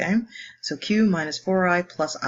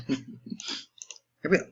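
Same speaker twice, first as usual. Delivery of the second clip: laughing softly; 5 dB quieter, much slower than usual. Real, not acted. Laughs after singsong.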